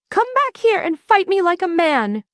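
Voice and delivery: high-pitched mild bellow. A narrator's reading. Monster challenges the teleporting hero, bellowing high. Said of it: "Crocodon bellows".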